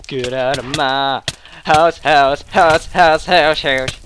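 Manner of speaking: low, softened echo of an order; low mock menace. Gamer ordering an opponent out, low repeating voice. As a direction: drop low and repeat the last word like an echo.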